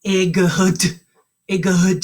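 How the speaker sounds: "Igud" sounded out letter by letter as ih-gud; silly wrong reading. Shown as a demonstration. Teacher shows kids how the letters sound if read literally. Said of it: "Like, watch".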